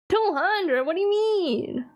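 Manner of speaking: high-pitched, incredulous mock outrage; mock disbelief. Querying a price of two hundred, exaggerated disbelief.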